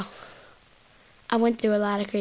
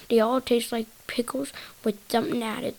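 ordinary talk

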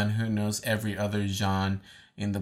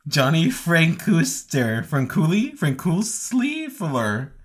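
slow, unsure; 7 semitones higher, 5 dB louder, slower than usual. Real, not acted. Struggling to read name.

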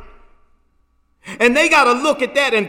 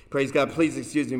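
normal talking voice

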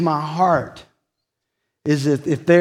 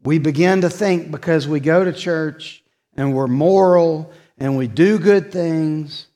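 ordinary delivery

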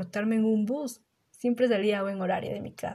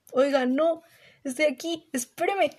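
mock calling out; 5 semitones higher than usual. Calling after the leaving bus.

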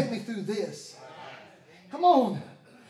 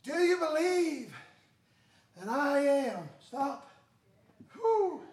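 ordinary talk

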